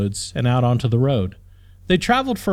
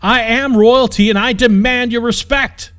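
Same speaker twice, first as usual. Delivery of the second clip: much higher, louder yell; outraged princess screams; furious scream; 10 semitones higher, 7 dB louder than usual. A narrator's reading. Princess demanding respect.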